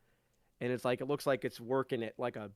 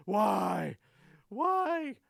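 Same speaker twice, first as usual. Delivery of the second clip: hammed-up anguished wail, the second Why much higher; shouting to the sky; 8 semitones higher, 4 dB louder than usual. GM voicing the wolf wailing at the sky, hammed-up shout. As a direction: howl it up at the sky in mock despair. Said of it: "shouting to the sky".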